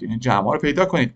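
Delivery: ordinary delivery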